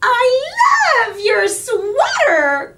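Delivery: high, loud, slow gushing; a new girl thrilled by a sweater; breathless gushing compliment; gasping delight. A narrator's reading. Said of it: "Carla gasped".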